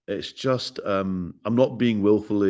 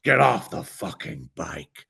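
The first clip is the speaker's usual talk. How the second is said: slow, drawn-out Cage yell; slow hammed-up Nicolas Cage rant; Nicolas Cage impression; 10 semitones higher, 9 dB louder, slower than usual. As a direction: ham it up like Nicolas Cage in The Wicker Man. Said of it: "The Wicker Man".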